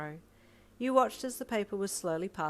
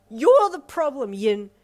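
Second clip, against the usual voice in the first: higher, much louder, slow venom; vehement; 7 semitones higher, 15 dB louder, slower than usual. Acted. Mother spitting blame at her daughter, vehement. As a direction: spit the words at her. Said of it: "she spat vehemently".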